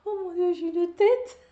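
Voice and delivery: high, loud shocked cry at her own reflection; dismayed outburst. Real, not acted. Knitter shocked by her look on camera, high loud exclaim.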